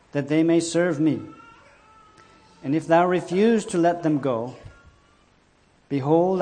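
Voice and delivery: loud, declaimed Bible verse; scripture reading. A narrator's reading. Preacher reading God's command to Moses, declaimed. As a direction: proclaim each phrase firmly and emphatically.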